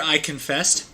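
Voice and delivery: ordinary delivery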